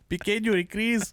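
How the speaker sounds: high mock Spanish accent; bad Spanish accent. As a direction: say it like a cheesy movie Spaniard.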